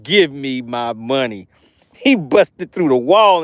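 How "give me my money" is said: lower, slow mock Hulk demand; a hint of the Incredible Hulk. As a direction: growl it out like a lightly voiced Hulk. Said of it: "like the Incredible Hulk".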